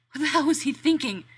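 high, loud self-scolding cry; exasperated outburst. Acted. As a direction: yell at yourself in disbelief. Danny berating himself, louder.

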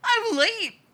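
high and loud, rising into a tearful whine; a drugged kid upset about being late; boy near tears over missing cookies; teary, whiny. A narrator's reading. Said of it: "eyes tearing up".

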